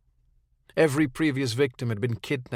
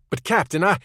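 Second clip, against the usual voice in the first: raised, higher protest, broken off; cut-off protest; 7 semitones higher, 6 dB louder than usual. Acted. Claire protests to the captain on the phone, raised and cut off. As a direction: try to get a word in and get cut off.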